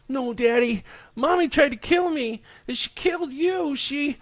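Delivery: put-on high, loud tearful boy; a boy insisting he's telling the truth; upset child. A narrator's reading. Crying boy tells his dad what mommy did, high and upset.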